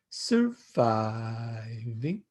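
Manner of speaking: slow drawn-out surviving with a lift at the end; three-syllable sing-song. Retold or imitated. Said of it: "in three sing-song syllables".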